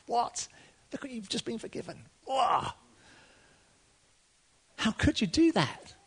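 high, incredulous protest; indignant outburst. Pretend. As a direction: voice the crowd's outrage. Imagined outraged listener.

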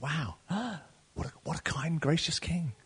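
soft, hushed wonder; awed gasp. Pretend. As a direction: marvel at the king's mercy. Imagined awed listener.

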